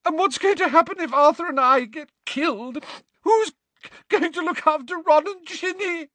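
high, stammering, loud crying outburst; Molly sobbing about the Order families; distraught crying. A narrator's reading. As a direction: pour out every fear while sobbing.

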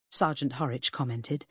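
ordinary delivery

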